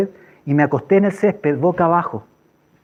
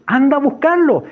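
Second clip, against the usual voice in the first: urgent command; 7 semitones higher than usual. Retold or imitated. Quotes the Lord's urgent command.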